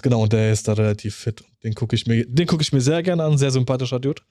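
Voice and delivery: usual speaking voice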